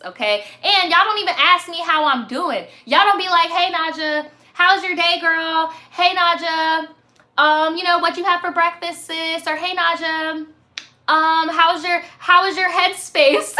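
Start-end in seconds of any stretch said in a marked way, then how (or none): 3.5-9.5 higher, louder mock-friendly check-in; lightly acted sweet fan greeting; imagined polite viewer. Host imitating how viewers should greet her, mock-friendly. 9.6-13.6 higher, mock-careful asking; hesitant, over-polite fan voice put on; imagined considerate viewer. Host modelling how viewers should ask, mock-considerate.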